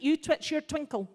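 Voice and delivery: ordinary talk, nothing marked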